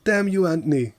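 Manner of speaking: imitating Earl fed up and yelling go away; mock exasperated shout. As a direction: play an irritated man shooing them off. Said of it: "If Earl's like".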